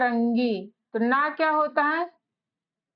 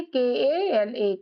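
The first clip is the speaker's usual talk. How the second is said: spelled out slowly; much slower than usual. Shown as a demonstration. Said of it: "तो काला को कैसे लिखे?".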